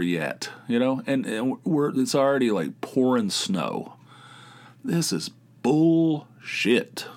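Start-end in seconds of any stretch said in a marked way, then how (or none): 4.8-7.1 stretched slow and high, disgusted; drawn-out gripe. Acted. Griping at the early snowfall, slow and drawn out. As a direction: drag the words out in mock disgust.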